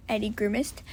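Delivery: usual speaking voice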